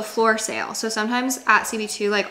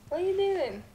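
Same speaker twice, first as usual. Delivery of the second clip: high pet talk; 10 semitones higher, 7 dB quieter, faster than usual. Real, not acted. Talking to her dogs.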